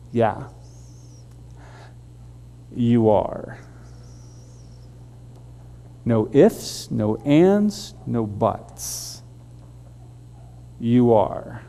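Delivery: low, quiet, lightly voiced God; a hint of God speaking softly from above; God's answer. God answering Cain, in a low, quiet voice.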